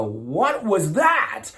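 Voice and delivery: loud, high, drawn-out mock astonishment; imitated amazed audience. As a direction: stretch it out like a stunned crowd. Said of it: "they go".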